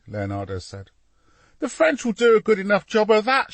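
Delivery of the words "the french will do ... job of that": high, loud youngster's shout. A narrator's reading. Boy to papa while shaking scaffold, shouting. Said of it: "shouted Soleil".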